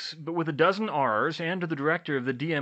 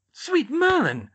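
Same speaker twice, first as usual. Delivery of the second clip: high, loud exclamation of shock; shocked gasp; 9 dB louder, slower than usual. A narrator's reading. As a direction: cry out, aghast. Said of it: "Pomfrey gasped".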